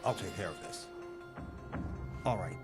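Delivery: low-pitched, steady coaxing; calm authority, voice kept low; calming, reassuring. Acted. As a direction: talk the agitated man down gently. Director soothes the agitated patient.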